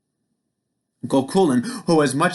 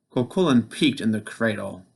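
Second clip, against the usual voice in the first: plain reading voice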